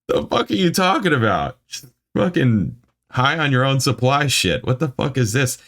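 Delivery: high-pitched, exasperated ranting; mad rant. Real, not acted. Reader rants at the review's writer, mad and baffled. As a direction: plead for sense, voice way up. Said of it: "this makes me mad".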